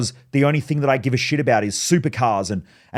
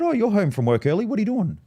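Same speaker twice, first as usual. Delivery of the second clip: mum surprised; 8 semitones higher than usual. Retold or imitated. Mum's surprised question, retold.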